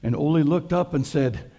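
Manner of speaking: ordinary delivery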